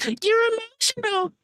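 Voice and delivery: high, whiny mock of the other guy; mocking falsetto needling. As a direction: squeak it out like a mocking imitation. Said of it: "he trying some more Shangri language".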